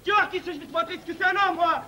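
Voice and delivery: high-pitched, indignant tirade; worked-up anger. Acted. Jilted groom threatens the supposed liar, agitated high rant. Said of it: "Ne vous énervez pas".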